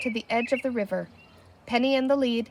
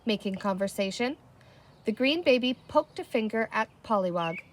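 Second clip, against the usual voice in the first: plain reading voice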